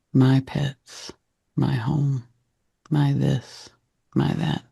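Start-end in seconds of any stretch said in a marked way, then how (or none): none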